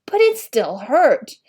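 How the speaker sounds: ordinary delivery, nothing marked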